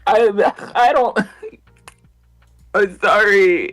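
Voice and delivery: high, slow fake sob; hammed-up sorry sob; mock crying. Mock crying over hit series.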